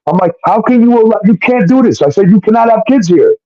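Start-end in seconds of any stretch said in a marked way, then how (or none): 0.4-2.0 higher-pitched, exasperated objection; exasperated protest. Retold or imitated. Telling Crystal no kids can stay here, exasperated. Acted quarrel over children in house. 2.3-3.3 firm, higher-pitched objection; exasperated protest. Retold or imitated. Telling Crystal she can't keep kids here, exasperated. Acted quarrel over children in house.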